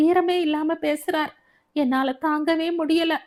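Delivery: high-pitched, wounded outburst; upset and indignant, pitched high; distressed complaint. Acted. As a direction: pour out the hurt to a close friend. Friend tearfully reports father's insults.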